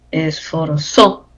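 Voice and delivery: "esforzó" slow, weight on the ending ZÓ; stress on final O. Shown as a demonstration. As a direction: land firmly on the last syllable of "esforzó". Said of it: "acento en la O por aguda".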